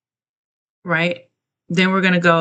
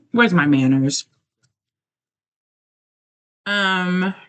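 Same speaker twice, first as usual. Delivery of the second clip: mouth full. Real, not acted. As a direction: speak around a spoonful. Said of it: "I'm eating my soup".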